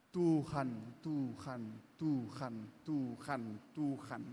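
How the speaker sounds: Tuhan drawn out very low and soft, like a fading ghostly echo; eerie low repeated Tuhan. Shown as a demonstration. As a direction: let each Tuhan sink low and soft until it sounds like hantu. Preacher repeats word fading into ghost-like moan.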